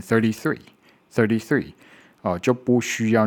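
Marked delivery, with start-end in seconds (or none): 0.0-1.7 thirty-three a little faster, relaxed tongue; quicker thirty-three. Shown as a demonstration. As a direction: run thirty-three together lightly. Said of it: "稍微念快一点". (稍微念快一点的thirty-three)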